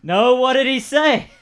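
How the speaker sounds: high, loud, slow manager calling back from afar; second voice, the manager. Acted. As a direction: answer from the back room, confused. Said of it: "two different voices that you're doing".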